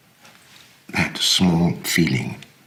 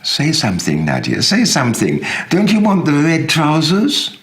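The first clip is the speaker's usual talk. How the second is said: louder and higher, rising to a desperate plea; pleading urgently; 12 semitones higher, 5 dB louder than usual. Acted. Uncle to silent niece, pleading louder and higher. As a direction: beg her to just answer you. Begging silent Nadia to answer.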